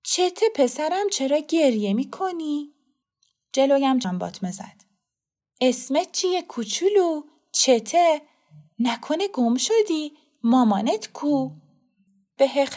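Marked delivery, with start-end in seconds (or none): 0.0-2.7 high, slow, tender coaxing; kind voice. Acted. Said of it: "با صدایی مهربان گفت". 5.6-11.5 put-on high, sing-song questions to a small child; kind woman. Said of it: "با صدایی مهربان گفت".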